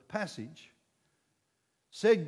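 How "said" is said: ordinary talk, nothing marked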